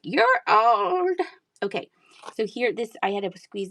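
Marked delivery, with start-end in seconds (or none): 0.0-1.2 high, louder mock verdict; mocking doctors. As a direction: parrot the doctors with a teasing high pitch. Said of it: "they're like".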